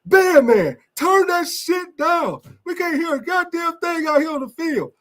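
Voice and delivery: high mock holler from the sideline; imitating a coach hollering at the band man. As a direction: yell up at the band like an angry coach. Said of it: "Our coach used to be hollering up in the stand".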